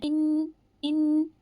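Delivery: इन with the n hummed long through the nose, very slow; इन, ghunna held; held nasal ghunna. Shown as a demonstration. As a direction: hold the n of इन as a nasal hum. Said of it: "तो आपको गुन्ना करना है".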